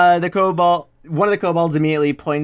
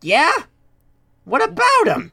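much higher, wary kobold voice; suspicious kobold; 11 semitones higher than usual. Acted. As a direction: challenge her warily. Kobold answers Jenny.

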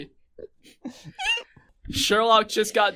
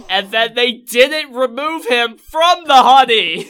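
loud exclaiming; 3 semitones higher, 8 dB louder than usual. Real, not acted. Climax of silly retelling.

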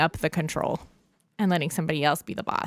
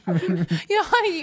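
laughing; 14 semitones higher, slower than usual. Real, not acted. Group laughing at the joke.

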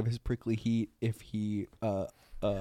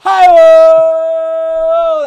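very high, loud, long-held holler; mock yell; 18 dB louder than usual. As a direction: stretch out a hammed-up yell. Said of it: "the nurse from behind yells".

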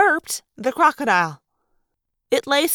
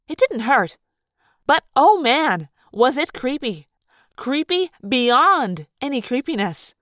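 usual speaking voice

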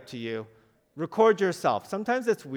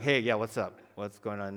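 murmured guy talk; 6 semitones lower than usual. Retold or imitated. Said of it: "They just kinda murmur".

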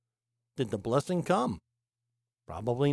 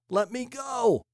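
much higher and louder, pressing hard; emphatic plea; 11 semitones higher, 4 dB louder than usual. Retold or imitated. The angel pleading to be released, emphatic and high. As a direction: belt it out as a desperate demand. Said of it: "notice the emphatic speak".

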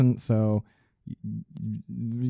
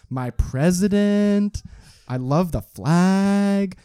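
high, very slow patriotic swooning; mock patriotism; 8 semitones higher, much slower than usual. Citizen adoring flag and president, mock patriotic fervour. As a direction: stretch each word in hammed-up national pride. Mocking July fourth patriotism.

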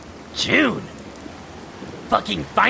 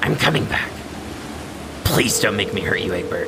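lower, grim warning; low threat; 9 semitones lower than usual. Acted. Terezi warning June she will hurt her, low and grim. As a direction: say it like a last warning.

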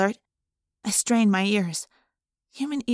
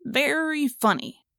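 sneering; 4 semitones higher, slower than usual. Acted. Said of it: "Marco sneered".

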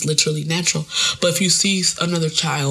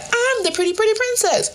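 put-on high, gleeful little girl; girly triumphant. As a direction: announce it like a winner. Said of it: "she was like".

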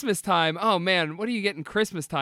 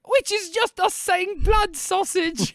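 high, drawn-out cartoon British accent; mock British accent; 11 semitones higher, slower than usual. Making fun of their take on British cuisine, high mock-British accent. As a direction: stretch it out in a silly high British voice. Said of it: "in this accent".

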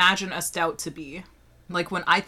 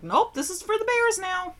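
a much higher, breezy "nope"; cheery dismissal; 11 semitones higher than usual. Retold or imitated. Tossing out ruined coffee in a bright, airy voice. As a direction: toss it off lightly, like it's nothing. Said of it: "I'd be like".

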